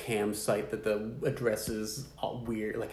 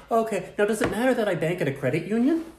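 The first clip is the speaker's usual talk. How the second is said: high, innocent playing-dumb voice; mock naive voice; 9 semitones higher, 4 dB louder than usual. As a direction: sound sweetly clueless.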